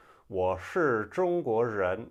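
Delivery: strong Beijing r-colouring, slow and loud; Beijing accent. Shown as a demonstration. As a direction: curl the r hard, Beijing style. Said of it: "in Beijing".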